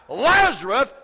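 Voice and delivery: high, loud shout of authority; commanding full-voice yell; hollering out. Shown as a demonstration. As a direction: holler the name to shake the walls. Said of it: "when he hollered out".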